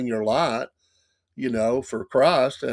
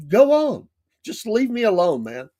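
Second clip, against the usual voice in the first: louder and higher, shooing him off; exasperated brush-off; 7 semitones higher, 4 dB louder than usual. Retold or imitated. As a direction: tell him to get lost, fed up. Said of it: "I said".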